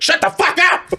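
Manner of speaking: high mock yell; wife yelling to shut up. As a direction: snap at him like an annoyed wife. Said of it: "my wife's like".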